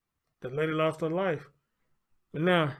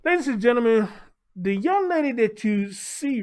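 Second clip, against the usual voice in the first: raised, announcing voice; loud announcing; 6 semitones higher, 5 dB louder than usual. Real, not acted. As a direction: call it out to the crowd. Announcing the story loudly.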